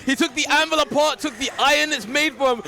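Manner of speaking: high, loud hype call; excited. Real, not acted. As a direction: shout the play-by-play. Hype call after the kill.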